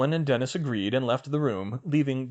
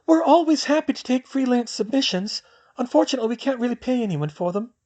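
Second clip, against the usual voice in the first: put-on higher girl's voice, a bit louder; dreamy girl's voice; 13 semitones higher, 5 dB louder than usual. Luna answering Hermione, in a higher girl's voice. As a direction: lift into the young girl.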